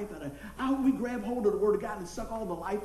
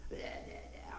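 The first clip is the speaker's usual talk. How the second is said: mumbled reluctant yeahs; 12 dB quieter than usual. Retold or imitated.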